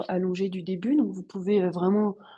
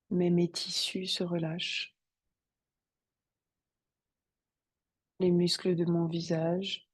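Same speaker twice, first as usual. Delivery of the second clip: guided meditation; 2 semitones lower, 6 dB quieter than usual. Acted. Yoga teacher guiding students to settle on the mat, meditative calm. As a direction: settle them calmly into stillness, unhurried.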